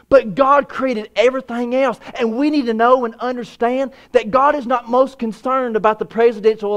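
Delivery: rising fervent preacher's cry; full-force preaching. Acted. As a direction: preach it hard to the congregation.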